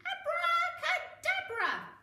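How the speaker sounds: loud abracadabra flourish; magic spell. Acted. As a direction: build up to a big spell. Casting a spell.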